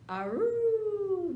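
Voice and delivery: high howling aroo; loud baying dog howl. Acted. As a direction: howl it out like a hound.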